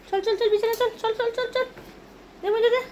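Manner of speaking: high sing-song call to a dog; excited calling. Real, not acted. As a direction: call the dog to bed eagerly.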